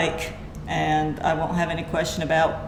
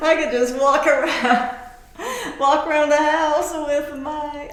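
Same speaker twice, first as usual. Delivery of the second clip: laughing through it, higher and louder; giggly and excited; 8 semitones higher, 3 dB louder than usual. Real, not acted. Still giggling over the gadget.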